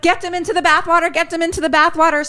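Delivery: fast, high, rushed command; acted-out emergency instruction; urgent order. Retelling Tangi's urgent order.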